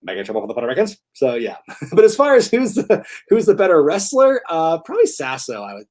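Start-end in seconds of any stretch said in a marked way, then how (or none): 0.0-1.0 "Making trouble for the Puerto Ricans" softly mumbled in a blurred rush; low, slurred imitation of a garbled "Making trouble for the Puerto Ricans"; rapid-fire garble. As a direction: run "Making trouble for the Puerto Ricans" together until indecipherable. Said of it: "this really rapid fire thing that was indecipherable".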